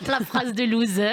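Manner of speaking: usual speaking voice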